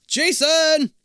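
loud high desperate call; crying out. A narrator's reading. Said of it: "you cry".

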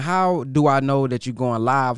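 ordinary talk

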